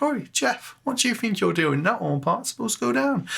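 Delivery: high-pitched, hammed-up Cockney accent scolding Jeff; bad Cockney accent. Imagined viewer scolding Jeff over the horn part, bad Cockney accent. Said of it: "really bad Cockney accent".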